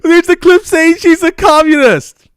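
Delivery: very high, loud mock shriek; imitated yell. Debater re-enacts how his opponent yelled about a clip, mock yelling. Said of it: "And later he yells".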